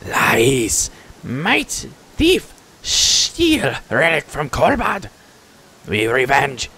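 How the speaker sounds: high, drawn-out kobold outcry; fun creature voice. Acted. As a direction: cry out accusations in pidgin creature speech. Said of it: "Fun voices".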